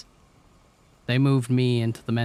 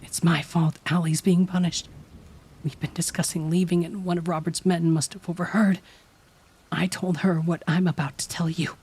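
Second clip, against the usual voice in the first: Cheryl urgent; 8 semitones higher, 3 dB louder than usual. A narrator's reading. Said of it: "explaining her plan".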